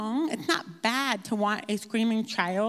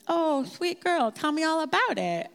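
mock tender mom; 7 semitones higher than usual. Mother hushing her crying baby, mock tender higher coo. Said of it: "I'm not like".

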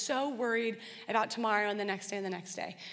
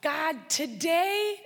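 "today," drawn out very slowly and raised; stress on today; 3 semitones higher, much slower than usual. Shown as a demonstration. Praying to God and weighting "today". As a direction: linger on "today" as a prayer. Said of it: "to focus on the present".

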